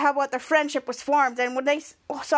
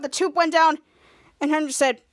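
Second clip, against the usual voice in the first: ordinary delivery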